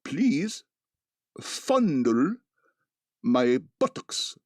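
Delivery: slow, odd foreign-tourist voice; Monty Python phrasebook voice. Retold or imitated. Retelling the Monty Python phrasebook sketch in a mock tourist voice. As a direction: read it stiffly from a phrasebook. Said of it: "Monty Python bit".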